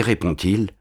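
plain reading voice

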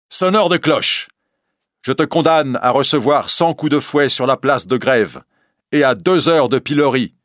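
high, loud verdict; angry sentence. A narrator's reading. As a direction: pronounce the sentence in anger. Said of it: "rouge de colère". (le prévôt en colère prononce la sentence, haut et fort)

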